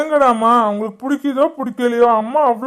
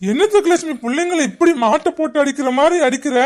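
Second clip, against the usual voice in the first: ordinary talk, nothing marked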